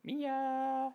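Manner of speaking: high, sing-song call of the dog's name. Real, not acted. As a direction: call her in a lilting voice. Calling the dog.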